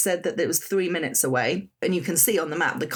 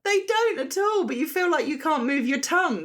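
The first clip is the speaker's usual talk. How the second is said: amused; 9 semitones higher than usual. Real, not acted. Still laughing, trying again.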